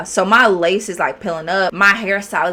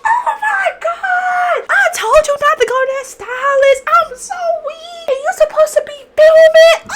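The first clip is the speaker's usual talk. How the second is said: mocking hysterical laugh; 5 dB louder than usual. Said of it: "This girl starts laughing hysterically".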